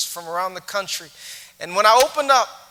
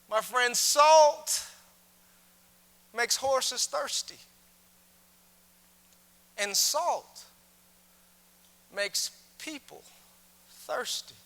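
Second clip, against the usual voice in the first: slow preaching; 4 semitones higher, slower than usual. Acted. Preacher slows for the point.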